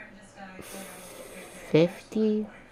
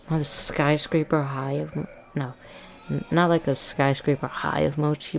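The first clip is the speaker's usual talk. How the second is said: usual speaking voice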